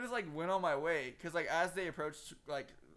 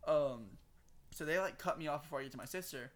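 normal talking voice

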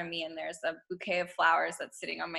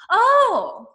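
high, loud "oh" settling into eager lift; excited self-talk; 5 semitones higher, 6 dB louder than usual. Acted. Guest voicing her reply to creeping self-doubt, excited. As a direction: greet the challenge with acted delight.

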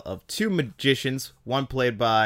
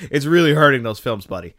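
louder, higher, laughing through REALLY; laugh, then hit "really" hard and high; real amused exasperation, stressing "really"; laughing emphasis; 3 semitones higher, 8 dB louder than usual. Real, not acted. Laughing, emphatic repeat of criticism.

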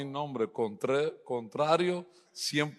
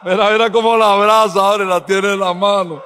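laughing tease; 6 semitones higher, 12 dB louder than usual. Real, not acted. Teasing the couple in the congregation.

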